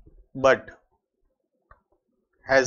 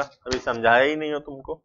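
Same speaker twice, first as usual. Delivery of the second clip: ordinary talk, nothing marked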